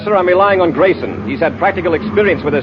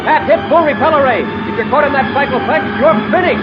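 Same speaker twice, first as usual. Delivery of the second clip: desperate shouting; 12 semitones higher than usual. Acted. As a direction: yell the last warning as if it may be too late. Commander's last desperate warning.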